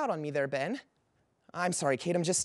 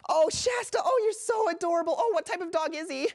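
very high, squealing puppy adoration; gushing; 11 semitones higher, 3 dB louder than usual. Acted. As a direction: coo loudly in delight over a puppy.

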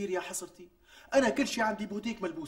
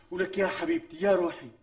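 normal talking voice

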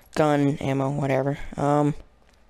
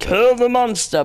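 higher-pitched playful war cry; mock battle cry; 6 semitones higher, 3 dB louder than usual. Player hyping themself up to kill the monster, high mock battle cry. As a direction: call it out high, hamming up the attack. Calling out in horror game.

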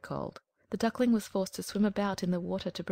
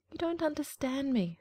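hurt duckling voice; 7 semitones higher than usual. A narrator's reading. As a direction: plead to be understood. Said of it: "said the Duckling".

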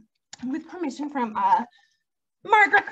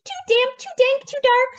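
high, loud squeaky mouse; mouse squeak; 7 semitones higher, 5 dB louder than usual. Acted. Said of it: "Mouse squeaks".

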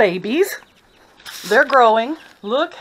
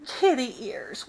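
ordinary talk

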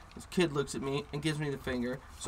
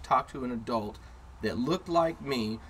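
ordinary talk

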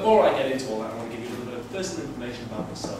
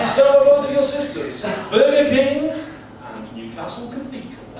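cheerful dad explaining; 12 semitones higher, 9 dB louder, slower than usual. Retold or imitated. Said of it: "stupid names".

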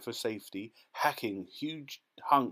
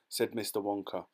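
usual speaking voice

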